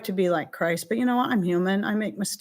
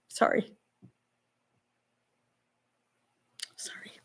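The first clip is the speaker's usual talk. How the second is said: choked up; 10 dB quieter than usual. Real, not acted. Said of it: "I didn't mean to get emotional".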